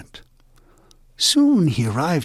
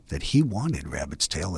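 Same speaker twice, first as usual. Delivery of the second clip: hushed, conspiratorial whisper; secret whisper; 8 semitones lower, 6 dB quieter than usual. A narrator's reading. Narrator whispering Coyote's scheming wish. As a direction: let it slip out like a secret.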